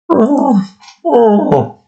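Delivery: very high, squeaky animal "oh"; high bear cry. Acted. A bear making its weird noise, very high. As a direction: yelp it like a startled animal. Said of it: "that weird noise they make".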